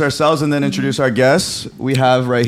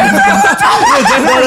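loud high laugh; 5 dB louder than usual. Real, not acted. Big laugh at the joke.

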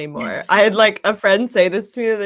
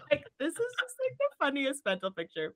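high, soft laughing speech; 9 semitones higher, 9 dB quieter than usual. Real, not acted. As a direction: squeeze the words out through real laughter. Laughing at her own mental picture.

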